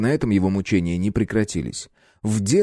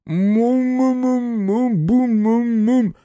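muffled frantic mumming through a gag; gagged mumbling; 4 dB louder, much slower than usual. A narrator's reading. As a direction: struggle against the gag. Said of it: "задёргался под ней воришка".

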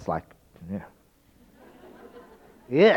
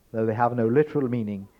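ordinary talk, nothing marked

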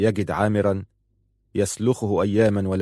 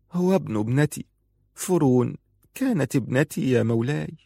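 put-on higher, pleading older woman's part; hasty grieving grandmother; 5 semitones higher than usual. A narrator's reading. Grieving mother answers her lord about her grandson, high and earnest. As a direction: answer promptly in a high mourning voice. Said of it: "قالت مسرعة".

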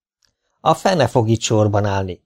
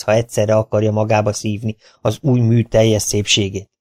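ordinary delivery, nothing marked

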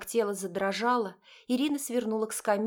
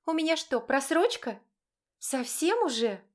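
high, outraged disbelief; indignant; 5 semitones higher than usual. A narrator's reading. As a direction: bristle with indignation. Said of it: "с возмущением произнесла".

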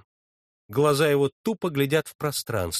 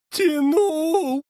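much higher, crying yet sarcastic; half-sobbing, half-mocking; tearful mocking; 13 semitones higher, 4 dB louder than usual. A narrator's reading. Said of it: "плачущим и в то же время насмешливым голосом". (плачущим и насмешливым голосом)

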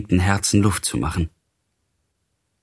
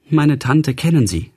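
higher-pitched voicing of an upset young lady; distressed young lady; 7 semitones higher than usual. A narrator's reading. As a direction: lightly voice her, pitch lifted. (bekümmertes Fräulein, mit höherer Stimme)

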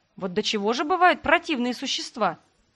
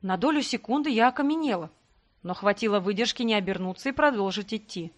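plain reading voice

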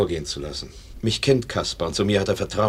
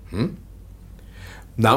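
ordinary talk, nothing marked

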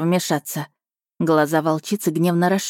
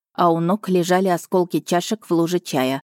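ordinary delivery

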